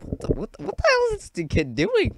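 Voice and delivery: very high, squeaky mock disbelief; baffled onlooker. Classmates staring at the noisy kid, squeaky bafflement. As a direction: squeak it out as a stunned bystander.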